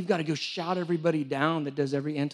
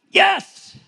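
excited yes; 13 semitones higher, 11 dB louder than usual. Real, not acted. Sudden recall of the point.

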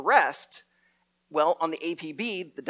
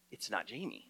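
low, much softer hushed realization; stunned realization; 9 semitones lower, 15 dB quieter than usual. Retold or imitated. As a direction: let it dawn on you under your breath. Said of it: "she suddenly realizes".

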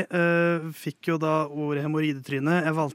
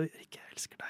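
hushed, fully whispered declaration; lover's whisper; 22 dB quieter than usual. Acted. Dream guy leaning in at the cinema, whispered. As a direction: whisper it close to the ear. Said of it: "kysser deg på kinnet og hvisker".

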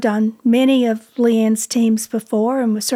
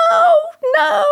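high wailed refusal, acted out; drawn-out mock wail; imitated distraught protest; 3 dB louder than usual. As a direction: mimic an upset woman crying out. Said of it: "And she just sobbed".

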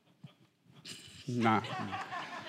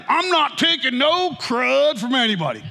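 mock defiant; 9 semitones higher, 3 dB louder than usual. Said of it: "We're like".

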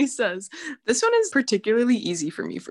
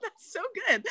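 laughing in disbelief; 11 semitones higher than usual. Real, not acted. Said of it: "friend amazed, laughing hard".

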